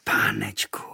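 breathy whispered wonder; boy marvelling at a cosy room, whispered. Acted. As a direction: whisper it in awe.

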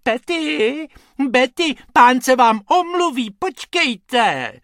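lady sweetly soothing her lapdogs; sweet little voice. A narrator's reading. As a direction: coo to the little dogs. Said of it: "sladkým hláskem volá".

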